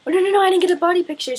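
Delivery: fast panicked no no no. Retold or imitated. Idol leaving, panic.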